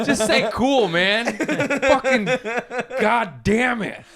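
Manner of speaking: high, laughing exasperation; exasperated. Retold or imitated. Son exasperated by dad's doubts about merch, half-laughing. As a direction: plead in high mock frustration, ending drawn out.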